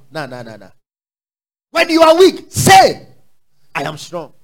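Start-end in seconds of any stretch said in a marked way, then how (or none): 1.7-4.3 preaching loud. Acted. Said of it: "preaching declaration".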